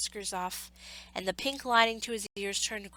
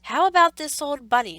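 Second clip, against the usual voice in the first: higher, louder nanny voice; a higher, louder nanny asking; Nana's question; 6 semitones higher, 12 dB louder than usual. A narrator's reading. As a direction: ask the doctor briskly. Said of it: "she asked".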